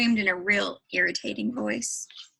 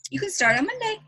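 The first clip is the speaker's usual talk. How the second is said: clerk giving in; 8 semitones higher, 3 dB louder, faster than usual. A narrator's reading. Shy clerk gives the job.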